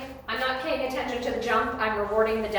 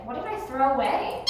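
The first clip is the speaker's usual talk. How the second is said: usual speaking voice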